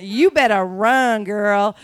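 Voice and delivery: slow, drawn-out American impersonation; American woman voice. Retold or imitated. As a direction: mimic her, dragging out each word. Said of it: "She went".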